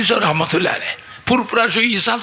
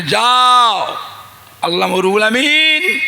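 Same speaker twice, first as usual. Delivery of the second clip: higher, louder drawn-out order; raised-voice master's command; acted command; 3 semitones higher, 4 dB louder, slower than usual. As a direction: send the disciple off with a ringing call.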